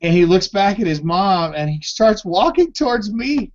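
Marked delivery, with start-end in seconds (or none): 1.5-3.5 high, squeaky laughter through the words; can barely get it out for laughing; story told mid-giggle, voice cracking high. Real, not acted. Laughing while telling story.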